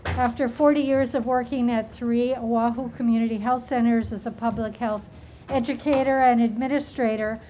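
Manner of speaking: ordinary delivery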